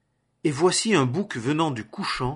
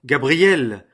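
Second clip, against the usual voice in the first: calling out; 7 dB louder than usual. A narrator's reading. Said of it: "il cria et dit".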